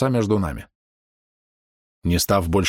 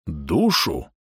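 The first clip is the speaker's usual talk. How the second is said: hoarse mutter; 5 semitones lower than usual. A narrator's reading. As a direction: mutter it hoarsely, half to yourself. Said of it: "хрипло пробормотал Бом".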